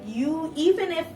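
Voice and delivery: sudden high loud burst; indignant outburst. Real, not acted. Venting at the ex who texted her, a sudden loud burst. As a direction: explode at the betrayal. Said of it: "indignant outburst".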